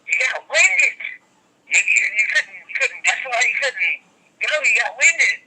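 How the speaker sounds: talking down to a beaten wrestler; mock coach voice. Dude scolding him backstage after the match, mock scolding. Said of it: "He's like".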